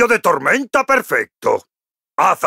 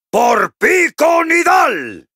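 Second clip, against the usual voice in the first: high, drawn-out rallying shout; battle cry; 10 semitones higher, slower than usual. Acted. Dwarf rider charging for his homeland, rousing battle cry.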